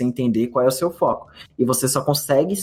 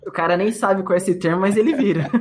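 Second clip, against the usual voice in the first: higher-pitched, laughing through the words; real amused laughing; 5 semitones higher than usual. Real, not acted. Trader joking with co-host about stubborn accidental investors, laughing. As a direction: crack up while making the joke.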